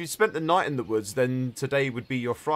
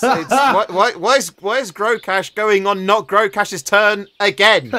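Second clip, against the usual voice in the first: loud, high exasperated protest; 7 semitones higher, 10 dB louder, slower than usual. Real, not acted. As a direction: raise your voice in disbelief. DM protesting a turn mix-up.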